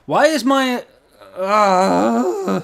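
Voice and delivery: high, loud, whiny complaint; exasperated whine. Real, not acted. Streamer whines loudly at the game.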